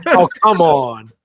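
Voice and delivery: long-suffering sympathy for a sick friend; exasperated groan. Real, not acted. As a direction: drag it out in mock outrage. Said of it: "reacting to the story".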